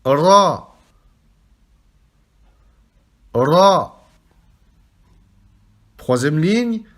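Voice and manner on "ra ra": Ra with a strongly trilled R, loud; rolled R. Shown as a demonstration. Teacher repeating Ra for students, rolled R. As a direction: roll the R hard in Ra. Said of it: "Vous roulez le R".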